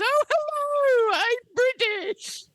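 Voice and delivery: high, slow posh-British put-on; over-the-top fake English accent; mock British accent. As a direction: ham up a silly British accent. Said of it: "Nobody, nobody wants me to do this".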